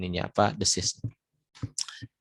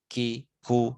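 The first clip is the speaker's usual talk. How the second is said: ordinary delivery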